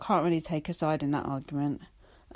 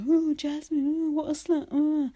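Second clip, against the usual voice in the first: high, lightly mocking gossip voice; a hint of sneering judgmental viewers; 8 semitones higher than usual. Mocking what everyone says about Jasmine, in a high sneer. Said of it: "everyone's like".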